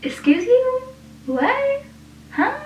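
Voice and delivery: high squeaky disbelief, pitch shooting up; a hammed-up excuse me, what; incredulous. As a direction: act offended and baffled at once. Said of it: "I'm like, um".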